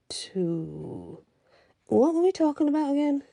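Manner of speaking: trailing off, then a high puzzled question; forgetful high question; confused. A narrator's reading. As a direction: lose the thread and pitch up. Scar forgets mid-sentence.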